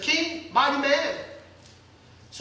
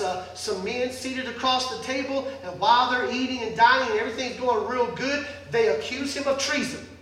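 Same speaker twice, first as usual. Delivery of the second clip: normal talking voice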